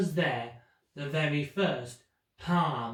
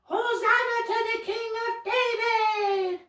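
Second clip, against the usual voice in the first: crowd cheering; 4 dB louder than usual. Retold or imitated. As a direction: hail the king like a jubilant crowd. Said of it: "waving banners and shouting".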